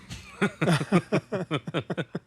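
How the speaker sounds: bursts out in a high laugh; real laugh. Real, not acted. Laughing at sponsor's blunt words on the phone, real laugh.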